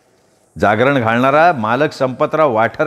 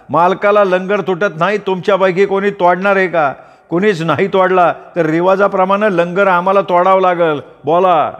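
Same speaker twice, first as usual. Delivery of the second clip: higher, ringing challenge to the crowd; calling out; 5 semitones higher than usual. A narrator's reading. Nama daring the crowd to break the chain, a ringing call. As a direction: throw the dare out to everyone.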